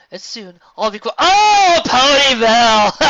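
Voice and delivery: high, slow stunned cry; sudden realization cry. Acted. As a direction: cry out the name in shock. Luna realizes, cries out.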